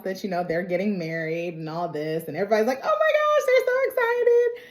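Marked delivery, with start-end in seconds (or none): 2.8-4.6 very high mock squeal of delight; gushing excitement. Everybody hears they're getting married, squealing mock excitement. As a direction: imitate a thrilled crowd, pitch way up. Everybody reacts to the engagement news.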